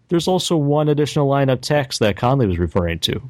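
ordinary talk

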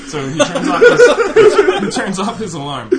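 can barely get it out for laughing; giggling retelling; laughing through words. Real, not acted.

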